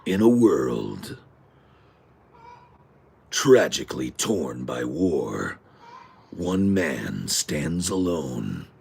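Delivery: slow, dramatic trailer narrator; movie trailer voice. Retold or imitated. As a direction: intone it like an epic film trailer, drawing out each phrase. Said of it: "guy that used to do the movie trailers".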